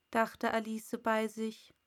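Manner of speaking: usual speaking voice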